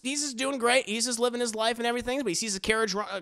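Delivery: ordinary delivery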